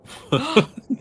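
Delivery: high, loud gasp of surprise; mock gasp. Friend reacts to a revived character, sharp surprised gasp. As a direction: gasp in playful astonishment. Hears a familiar character reborn.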